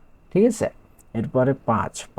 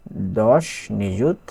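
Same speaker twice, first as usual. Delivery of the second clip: দশ নিযুত drawn out slow and louder, নিযুত rising; slow emphatic stress; 5 dB louder, much slower than usual. Shown as a demonstration. Teacher stressing দশ নিযুত to students, slow and loud. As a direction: lean hard on দশ নিযুত, slowly.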